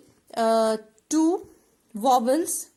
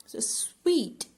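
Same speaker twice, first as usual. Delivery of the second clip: whispered demo; 3 semitones higher than usual. Shown as a demonstration. Teacher whispering the word for the class. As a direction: hush it, letting sweet come out as one breath. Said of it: "only one syllable".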